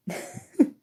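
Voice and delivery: short, voiceless chuckle; airy little snicker; amused chuckle. Acted. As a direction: laugh briefly under your breath. Sorcerer laughs at listener's reaction.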